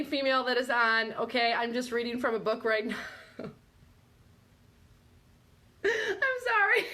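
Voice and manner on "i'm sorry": high, slow, squeaky with laughter; real tearful giggles; helpless laughing apology. Real, not acted. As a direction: apologise while cracking up. Apologises while cracking up.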